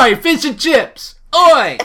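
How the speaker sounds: high, loud mock Cockney heckle; mock British yob. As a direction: heckle like a drunk British teen, hammed-up. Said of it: "do an impression of it".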